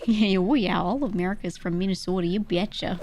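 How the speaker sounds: folksy exaggerated Minnesotan; mock Minnesota accent. Streamer parodying all of America, mock Minnesota accent. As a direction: lean on Minnesota long O's and lilt. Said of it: "That's what they all sound like".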